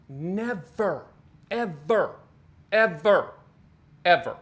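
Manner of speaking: NEVER and each EVER set apart by pauses; emphatic warning. Real, not acted. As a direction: hammer each EVER as its own punch. Hammering a warning word by word.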